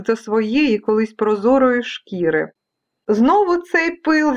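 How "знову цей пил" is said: high, slow weary complaint; exasperated storybook mother; sighing mum. Acted. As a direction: sigh it out in exasperation. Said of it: "зітхнула мама".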